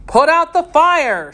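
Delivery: high, loud captain's order; captain shouting to his men in battle; urgent shout. A narrator's reading. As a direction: bark it across the smoky room. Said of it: "shouted the captain".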